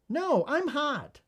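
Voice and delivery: put-on high, slow kid's whine; protesting child. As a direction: play a stubborn child, stretching the words high. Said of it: "The child".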